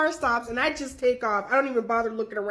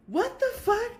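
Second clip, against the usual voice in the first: high, incredulous mock reaction; a lightly voiced stunned old man; baffled; 11 semitones higher than usual. Gentleman's baffled look voiced.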